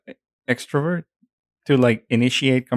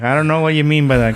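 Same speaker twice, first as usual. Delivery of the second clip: fast, higher, louder retort; mock indignant; 5 semitones higher, 6 dB louder, faster than usual.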